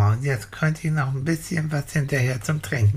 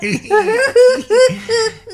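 shrieking high giggle; high squealing laugh; 9 dB louder than usual. Acted. Belly bump, loud laugh.

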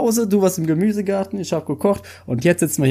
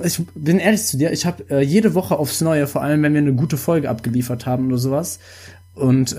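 normal talking voice

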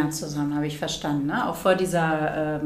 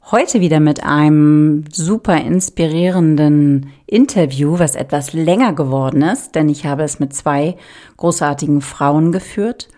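louder, polished intro announcement; podcast intro read; 10 dB louder than usual. Acted. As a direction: present the episode like a host reading her opener. Vorbereitetes Podcast-Intro gelesen. (lauter, gelesener Podcast-Intro-Ton)

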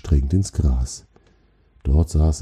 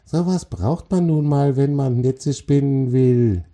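high, impatient spider voice; 9 semitones higher than usual. A narrator's reading. Spider explains spinning glands to the troll, impatient. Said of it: "antwortete die Spinne ungeduldig".

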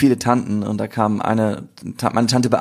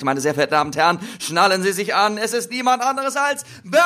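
building grand announcer build-up; announcer intro; 5 semitones higher than usual. Acted. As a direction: hype up the guest like a showman. Kündigt den Gast an. (feierliche Ankündigung wie ein Showmaster)

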